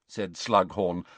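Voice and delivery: ordinary delivery, nothing marked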